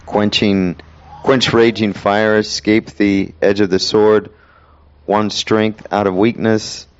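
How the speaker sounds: slow, measured reading voice; solemn Bible reading, unhurried; slow scripture reading. Acted. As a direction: linger over each phrase. Continues slow Hebrews reading.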